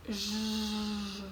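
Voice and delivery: a soft drawn-out zh like a beetle; beetle buzz. Shown as a demonstration. Teacher imitates the beetle for students, soft buzzing zh. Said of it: "the beetle makes the buzzing sound".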